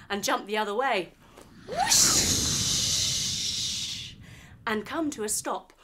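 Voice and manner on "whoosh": long airy whoosh rising up; breathy whoosh. Acted. Hoverboard turns the corner.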